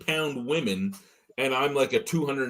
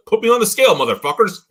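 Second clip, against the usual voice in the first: loud, fed-up challenge; defiant shout; 8 semitones higher, 10 dB louder, faster than usual. Retold or imitated. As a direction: yell it as a dare. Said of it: "I'm like".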